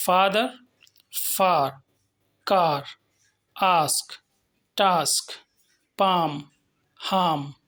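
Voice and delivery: "फादर" with the aa stretched long; "फार", "कार" with a long, held aa; long aa vowel. Shown as a demonstration. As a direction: draw out the aa in each word. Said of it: "इसको हमने खींच करके बोला है".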